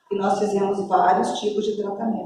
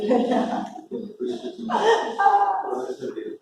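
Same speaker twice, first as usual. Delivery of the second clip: breathy laugh; 7 semitones higher, 4 dB quieter than usual. Real, not acted. Laughing while telling story.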